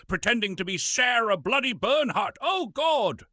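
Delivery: high, ringing indignant tirade; furious theatrical rant. Acted. Washed-up actor cursing the people who hired him, furious high rant. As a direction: rail at them like a slighted old ham. Ranting at the producers.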